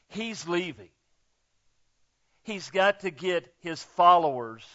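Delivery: ordinary talk, nothing marked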